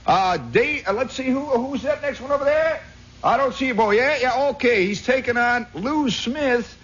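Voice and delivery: high, carrying ringmaster call; hammed-up country fight announcer; pit announcer. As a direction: announce the bout to the whole stands. Acted cockfight announcer continues.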